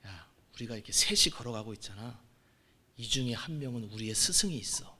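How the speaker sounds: mock-wise hush to his friends; hushed sage quote. A young man quoting sages to his friends, whispering.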